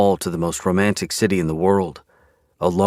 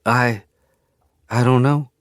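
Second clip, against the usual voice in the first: dazed, higher female voice; a hint of numb distance; far-away voice; 6 semitones higher, 3 dB louder than usual. A narrator's reading. Widow answers police, lightly dazed. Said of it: "Winifred's voice sounds far away".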